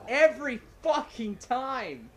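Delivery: loud, high, drawn-out frustration; exasperated yell. Real, not acted. Gamer who dies every fucking time, exasperated yell. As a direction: stretch it out, loudly fed up. Player outraged at repeated death.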